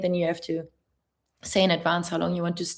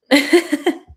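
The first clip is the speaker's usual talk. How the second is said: high, loud giggle; short high burst of laughter; awkward laugh; 8 semitones higher, 8 dB louder than usual. Real, not acted. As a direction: laugh off the question. Laughs after being careful.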